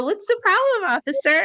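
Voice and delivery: high, sugary innocent question; sweet innocent voice. Retold or imitated. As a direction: play the sweet good girl for the cop. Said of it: "I put on my sweetest smile".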